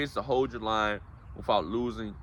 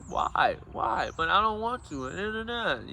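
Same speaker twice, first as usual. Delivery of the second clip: put-on high-pitched whining kid voice, protesting; whiny child; 9 semitones higher, slower than usual. Parent imitating a child refusing bedtime, high and whiny. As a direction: whine like a kid who won't go to bed. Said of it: "you know, kids".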